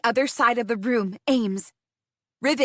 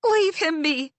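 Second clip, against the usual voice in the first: higher, urgent plea; shouted plea; 6 semitones higher than usual. Acted. Narrator pleading for Riven, urgent shout. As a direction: call out to spare him. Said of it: "I shout".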